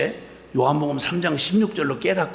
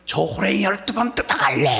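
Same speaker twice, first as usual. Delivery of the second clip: imitating mother's angry curse; 4 semitones higher than usual. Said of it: "악에 받쳐서 하신 말씀이".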